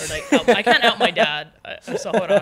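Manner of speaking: embarrassed real laughter while backpedaling on air; laughing. Real, not acted. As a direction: laugh as you catch yourself mid-slip. Catches herself nearly outing dad.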